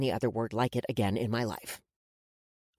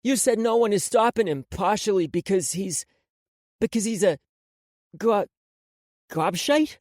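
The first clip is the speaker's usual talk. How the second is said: put-on higher, louder kid's voice, halting; hesitant boy; 5 semitones higher, 5 dB louder than usual. A narrator's reading. Boy asks the woman about the insult, hesitant. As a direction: edge up to the rude word. Marco hesitantly repeats the word.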